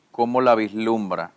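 ordinary talk

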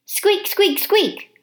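high squeaky little hamster voice; hamster squeaks. Acted. Hamster squeaking at the janitor. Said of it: "I tried to tell him".

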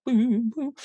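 high sung-out boo, boo; imitated glockenspiel tone. Shown as a demonstration. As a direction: mimic a retro space chime, up high. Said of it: "that real kind of boo".

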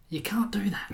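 hushed telling. Acted. Gleeful story telling.

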